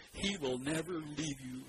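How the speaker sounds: slowed, deliberate reading for the crowd to echo; slow unison recitation. Shown as a demonstration. Pastor repeats verse with congregation, slow and steady. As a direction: lead them through it word by word. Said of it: "Say that with me".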